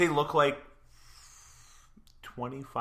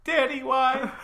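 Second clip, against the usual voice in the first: put-on high childish whine; whiny child; 5 dB louder than usual. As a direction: plead like a little kid. Voicing Broly to his father.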